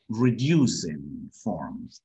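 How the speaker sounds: "reducing forms" drawn out very slowly; slow English phrase. Shown as a demonstration. Teacher presenting the term to students slowly. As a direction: spell it out slowly. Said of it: "Los nativos hablan".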